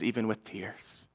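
very soft, low, understated grief; hushed imitation of someone weeping; retold sobbing. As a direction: let it sink almost to nothing, as if tearful. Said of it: "he starts sobbing".

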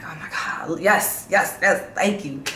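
a hushed oh my God, then a happy yes yes; imitating her own joy. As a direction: replay her own relieved little celebration. Mimics her own joyful reaction.

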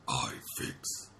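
low, whispery robot voice; deep breathy robot giant. Retold or imitated. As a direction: speak like a simple giant robot.

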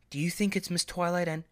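eager filly. Acted. Marigold prodding her sister about the heroes, eager high question. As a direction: ask it like an eager younger sister.

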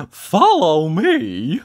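high, loud, drawn-out cheery call; Mister Happy. A narrator's reading. Happy visitor calls his companion through the wood, loud and cheery. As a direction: sing it out as a slow command. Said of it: "said Mister Happy".

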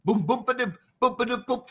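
chanted boom ba-dim beats, lightly voiced; bamboo drum rhythm. Shown as a demonstration. Storyteller imitates the tambu bamboo drum rhythm for his host, chanting. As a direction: sing the bamboo drum pattern like a beat. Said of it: "we use the bamboo".

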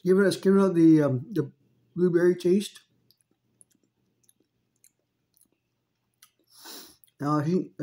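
chewing between words; talking around food; mouth partly full. Real, not acted. Seen 0: Holds fritter; mouth works between words, cheeks look partly full.

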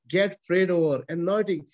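usual speaking voice

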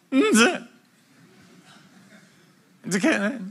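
strained high squeak of a word; awkward noise. Acted. Stuck for words in the aisle, strained high awkward sound. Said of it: "you just kinda let out noises".

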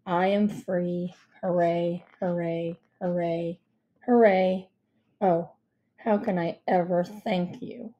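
low, deadpan celebration; bored monotone, evenly plodding; emotionless. Acted. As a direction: give thanks without a shred of gratitude. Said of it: "with no more emotion than before".

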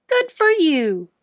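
squeaky hamster. A narrator's reading. Hamster cheering on his tired teacher. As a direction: squeak it encouragingly. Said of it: "I squeaked".

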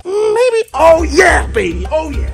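silly squeaky joking voice; high-pitched comic voice, hamming it up; goofy high voice. Acted. Joking bit, then "all jokes aside.

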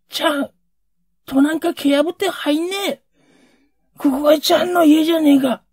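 high, loud, fast tearful outburst; wanted shout. Retold or imitated. Son longing to yell at his father outside, loud and high. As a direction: burst out at father to kick the door in. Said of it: "俺はこう怒鳴りたかった。".

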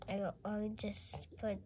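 sneaky whispered aside; whispering. Pretend. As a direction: whisper it so nobody hears. Sneaky dentist mutters aside.

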